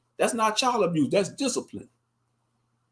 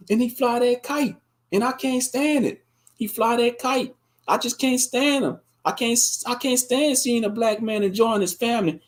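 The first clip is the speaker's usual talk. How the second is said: higher, whiny complaint about the kite; mock Karen; 5 semitones higher than usual. Karen calling police on a kite-flying father, higher whiny voice. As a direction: play a petty caller fed up. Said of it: "the dispatcher gonna be like".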